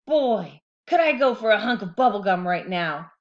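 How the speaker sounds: loud sly tooth voice; cartoon tooth teasing; taunting tooth. A narrator's reading. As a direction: tempt him with gum, loudly.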